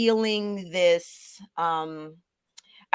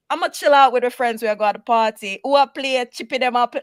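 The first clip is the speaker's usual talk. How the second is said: put-on relaxed switch into Caribbean accent, louder; 5 semitones higher, 6 dB louder than usual. Friend making party plans after mock trial, loud Caribbean accent. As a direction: let loose in lively Caribbean dialect. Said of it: "we go back to the accent".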